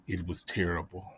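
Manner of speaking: normal talking voice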